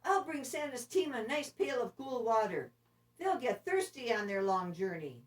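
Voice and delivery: put-on high, eager young reindeer; keen to be useful; eager Rudolph. Rudolph offers the team water, eager and high. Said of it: "said Rudolph".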